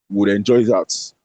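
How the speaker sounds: ordinary talk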